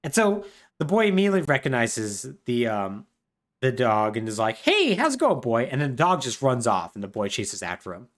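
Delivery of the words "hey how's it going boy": put-on high, happy kid voice; boy greets dog. Boy recognizes his dog at the freak show, high happy voice.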